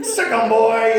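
high, loud call to a dog; rousing mock command; urging shout. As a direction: cheer them on like a hunting dog.

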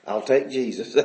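low, quiet and settled; a hint of calm conviction, said low; quiet firm resolve. Husband telling the rich townsfolk, quiet firm resolve. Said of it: "David said".

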